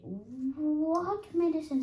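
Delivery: high, loud and slow dismay; alarmed question. A narrator's reading. Piglet dreading Kanga's medicine, asking high, loud and slow. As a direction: drag it out in horror.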